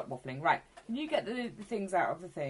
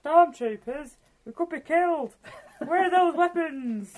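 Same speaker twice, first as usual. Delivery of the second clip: alarmed; 7 semitones higher, 8 dB louder, slower than usual. Acted. Character panics at approaching troopers.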